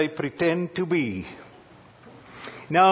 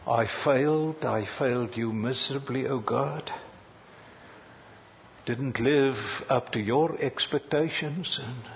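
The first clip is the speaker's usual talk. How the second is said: humble confession; 5 semitones lower, 7 dB quieter than usual. Retold or imitated. Confessing failure in prayer.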